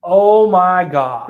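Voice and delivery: loud, slow, high-pitched cry of dismay; retold dismay. Client realizing his mistake, loud drawn-out oh my God. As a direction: stretch out the horror of realizing.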